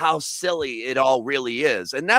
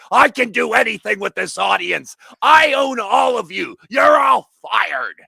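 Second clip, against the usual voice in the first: impersonating Vince McMahon; 5 semitones higher, 6 dB louder than usual. Retold or imitated. Quoting the corrupt wrestling boss to the hosts, loud gloat. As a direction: ham up the corrupt wrestling owner. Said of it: "he's pretty much impersonating, uh, Vince McMahon".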